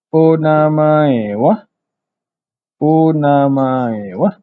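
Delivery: onamae wa drawn out slowly with a rising wa. Shown as a demonstration. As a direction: model onamae wa slowly for learners. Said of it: "Next adalah". (sebut onamae wa perlahan dengan wa menaik)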